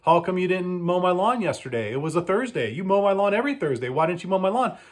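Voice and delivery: lightly voiced grumpy homeowner; mock annoyed customer. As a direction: imitate the customer's whiny demand. Customer voiced demanding payment.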